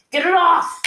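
desperate bellow, pitch climbing. Acted. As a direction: scream it as the panic peaks. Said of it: "Zhao bellowed".

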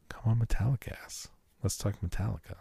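whisper. Real, not acted. Hushed rallying aside to listeners.

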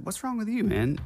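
high-pitched, puzzled retelling of his own question; mock bewildered "what's wrong with you, man?"; baffled retort. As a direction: ask it like you truly have no idea what's going on. Confused retort to angry coworker.

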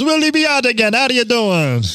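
high, lilting mock Jamaican accent; hammed-up Jamaican greeting, high and bright. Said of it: "you keep doing the accent".

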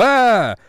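high, loud yelp of fright; acted scream at a haunted-house scare; mock scared scream.

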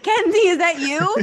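loud, high calling out. Real, not acted. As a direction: call out to a friend. Calling out to friend.

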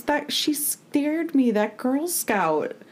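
put-on high, slow child voice, a bit frightened; a spooked little kid telling on someone; scared little girl. The little sister tells Dean the Girl Scout scared her, in a frightened child's voice. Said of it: "And she goes".

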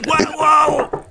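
thrown on stage too early, yelp; panicked exclamation. Retold or imitated. Comic panicking before going on.

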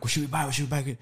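hushed retelling of a huddled question; imitating whisper. Friends huddled at the drive-through, imitated hushed whispering. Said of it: "We're all whispering".

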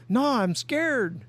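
high, slow, pleading mock fright; scared boater. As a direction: drag it out high like a frightened man refusing. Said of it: "And he goes".

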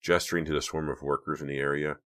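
ordinary delivery, nothing marked

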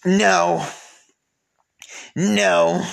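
high, loud, drawn-out no; emphatic refusal. Real, not acted. Host rejects the mental health excuse, loud emphatic refusal.

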